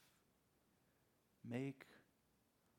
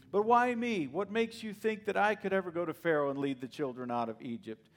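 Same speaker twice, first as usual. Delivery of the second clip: high, loud, pleading Moses making excuses; 5 semitones higher, 8 dB louder than usual. Acted. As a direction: beg God like a reluctant man with other plans. Moses' excuses read as a quote.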